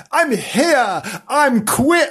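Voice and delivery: high, loud eager kitten voice; excited kitten. Acted. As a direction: burst out like a happy kitten. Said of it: "said Bernard".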